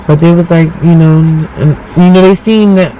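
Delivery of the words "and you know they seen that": ordinary delivery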